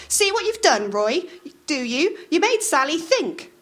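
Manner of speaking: bossy wife snapping at Roy; Maud scolding. A narrator's reading. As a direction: nag him to hurry up. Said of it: "Maud glared".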